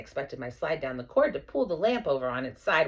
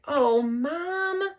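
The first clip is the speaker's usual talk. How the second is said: sighing; 11 semitones higher, 4 dB louder than usual. Acted. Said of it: "Gail sighed".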